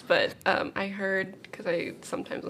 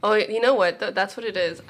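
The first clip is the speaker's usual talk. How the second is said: normal talking voice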